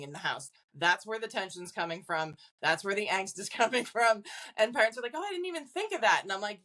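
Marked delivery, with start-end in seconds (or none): 5.1-6.2 high, surprised realisation; mock surprise. Parents realising the teenager is the source, high mock surprise. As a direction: parents suddenly getting it, pitched high.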